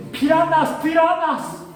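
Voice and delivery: "piranas" high and loud, mocking an English speaker; high mock gringo "piranas" with a plain n; English speaker's accent. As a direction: imitate an English speaker who cannot say ñ. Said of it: "Porque no pueden decir pirañas".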